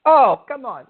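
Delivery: loud, high exasperated cry; outraged outburst. Acted. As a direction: blurt it out in shocked disbelief. Host outraged by prank caller.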